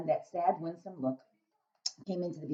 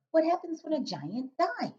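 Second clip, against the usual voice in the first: put-on little girl voice; 12 semitones higher, slower than usual. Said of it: "Sophie asked".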